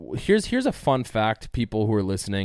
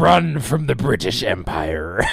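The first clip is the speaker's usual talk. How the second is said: pirate voice; 5 semitones higher, 6 dB louder than usual. Pretend. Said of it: "pirate guy".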